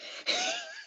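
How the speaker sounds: short high-pitched laugh, caught off guard; high squeal of laughter. Real, not acted. Laughing at being hard-headed.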